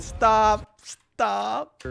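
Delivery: laughing plea to quit; exasperated stop; pleading stop. Real, not acted. Begging the others to stop.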